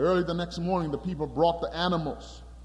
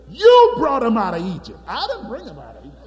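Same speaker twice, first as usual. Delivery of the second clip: indignant God; 2 semitones higher, 10 dB louder, faster than usual. Acted. Preacher voicing indignant God.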